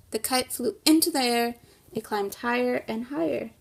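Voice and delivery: lively storybook read-aloud for a class; reading to children. Acted. Teacher reading the kite story to her class, in a lively storybook voice. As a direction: read it like story time for young kids.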